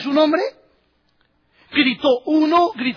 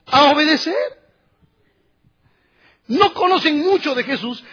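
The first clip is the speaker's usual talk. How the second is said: rising preaching; 2 semitones higher than usual. Acted. Preacher to congregation, building fervour. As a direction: drive home obedience to the congregation.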